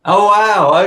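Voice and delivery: loud, high oh wow; surprised. Real, not acted. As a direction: sound genuinely taken aback. Reacting to surprise.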